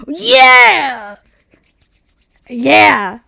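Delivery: loud excited shout; loud cheer. Real, not acted. Cheering about the car sitting on the lot, loud.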